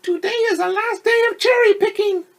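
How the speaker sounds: radio host signing off the season; radio woman. Acted. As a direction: announce it like a cheerful DJ. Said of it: "the woman on the radio said".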